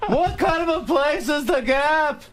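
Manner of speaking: very high, drawn-out yelling comedian; Jerry Seinfeld. Acted. Guest's observational bit about The Gap, high yelled impression. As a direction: yell each word slowly, way up high. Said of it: "So we're just yelling back and forth".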